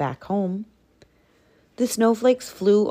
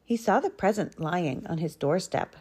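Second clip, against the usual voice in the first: ordinary delivery, nothing marked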